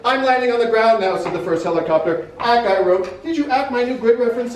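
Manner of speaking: loud, high, mounting urgency in the reading; urgent dramatic reading. A narrator's reading. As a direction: read it like radio chaos closing in. Frantic reading of radio chatter.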